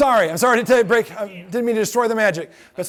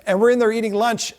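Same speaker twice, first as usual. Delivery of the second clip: usual speaking voice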